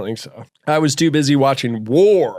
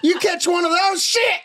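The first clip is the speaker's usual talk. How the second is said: hillbilly rant. Acted. Hick character ranting.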